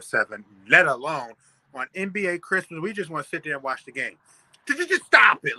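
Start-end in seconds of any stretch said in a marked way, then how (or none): 4.7-5.6 high, loud burst of exasperation; fed up, suddenly raising the voice; exasperated outburst. Real, not acted. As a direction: stammer into a loud, fed-up demand. Exasperated rant at the network.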